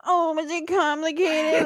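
high, cooing mock baby voice. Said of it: "in this baby voice".

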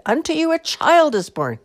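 loud, high-pitched yell; yelled announcement. A narrator's reading. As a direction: holler it at the shepherds. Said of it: "she yelled at the shepherds".

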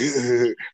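short mock laugh; imitated laughter. Neighbor coming outside amused, imitated laughing. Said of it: "He came outside laughing".

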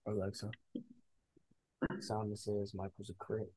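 hushed whisper to the speaker; whisper yelling. Real, not acted. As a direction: whisper it so she can't hear. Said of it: "Why you whispering?".

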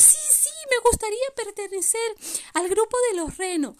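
vocecita aguda de renito entusiasmado; pequeño reno emocionado. Acted. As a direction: exclama muy agudo, con emoción actuada. (reno pequeño emocionado)

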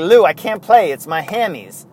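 Rickey Henderson. Retold or imitated. Player begging off to Lou, mock southern accent. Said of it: "That's why I do the southern impersonation".

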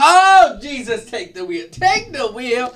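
dramatic Jesus take the wheel; exasperated. Real, not acted. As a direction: throw hands up in mock despair.

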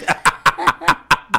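squealing laugh at own joke; high laugh. Real, not acted. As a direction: let a high laugh burst out.

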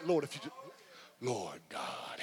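low, hushed mock prayer; lightly acted panic prayer; desperate prayer. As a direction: plead low like a scared sinner. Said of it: "you ever had in them prayer".

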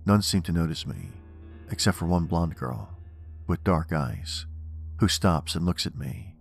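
plain reading voice

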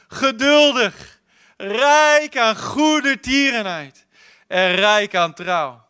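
God proclaiming his own name, slow and loud; slow proclamation. Acted. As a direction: proclaim each name slowly like a herald.

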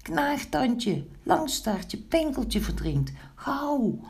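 very high, panicked storybook cries; shrieking. A narrator's reading. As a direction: call each friend in high alarm. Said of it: "gilde Zwart Snoetje". (hoog en paniekerig gillend)

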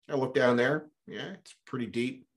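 ordinary talk, nothing marked

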